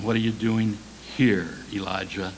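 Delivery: low, soft reassurance; careful, gentle God. Acted. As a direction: speak carefully, as to someone fragile. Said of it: "God spoke so carefully to him".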